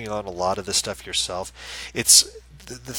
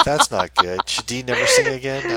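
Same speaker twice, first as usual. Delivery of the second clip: high, loud mock panic; hammed-up worried voice; mock alarm; 9 dB louder than usual. Co-host teasing Dee about the dropped numbers, mock alarm.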